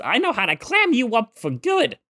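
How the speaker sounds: high-pitched cartoon cat voice; Meowth impression. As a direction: put on a high, cartoonish quip voice. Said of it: "I think Meowth calls it".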